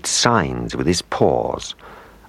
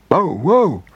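high, playful spoken bow-wow; dog's bark; 11 semitones higher than usual. A narrator's reading. Dog smiles back at his owner, high playful bark.

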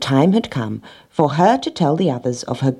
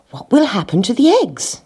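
higher-pitched, sudden question from a cat; sudden concern; 8 semitones higher than usual. A narrator's reading. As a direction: blurt the question with mild alarm. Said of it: "he said suddenly".

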